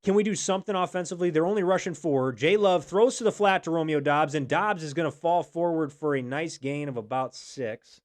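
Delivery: fast, loud sports call; play-by-play. Acted.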